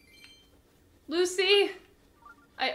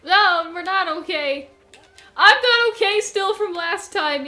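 panicked outburst; 3 semitones higher, 8 dB louder than usual. Real, not acted. Panicking at game danger.

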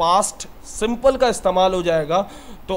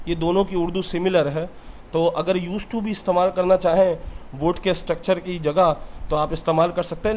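normal talking voice

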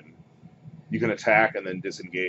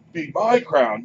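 higher, louder squabbling monster; arguing Ettin head; 6 semitones higher, 5 dB louder, slower than usual. Acted. First Ettin head arguing over the crown, raised insistent voice. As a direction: insist on it, punching MY. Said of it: "of course, they continue arguing".

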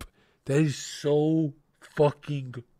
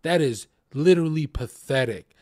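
ordinary talk, nothing marked

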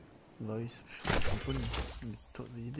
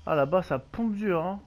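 excited; 4 semitones higher, 11 dB louder than usual. Real, not acted. Excited at gunfire.

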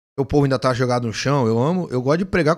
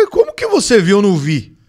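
amazed disbelief; 4 semitones higher, 9 dB louder than usual. Retold or imitated. Retelling his amazement at friend.

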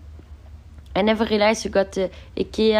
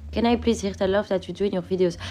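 ordinary talk, nothing marked

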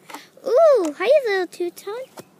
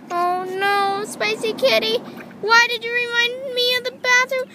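panicked doll; 8 semitones higher, 5 dB louder than usual. Pretend. Doll panics about bathroom.

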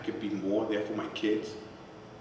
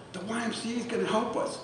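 excited son; 12 semitones higher than usual. Retold or imitated. Son announcing news.

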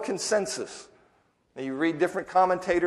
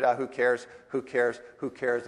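"who cares" fading quieter each time; dismissive shrug; 5 semitones lower, 5 dB quieter than usual. Retold or imitated. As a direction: brush it off, softer and softer. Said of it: "then you start squelching".

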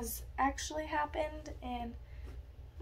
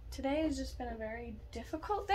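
ordinary delivery

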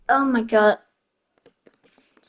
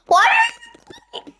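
high squeak; slower than usual. Real, not acted. Startled squeak.

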